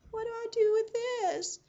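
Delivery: very high, loud, drawn-out wail; hammed-up squeal of helpless bewilderment; helpless panic. Nurse handed a fire extinguisher, wailing helplessly.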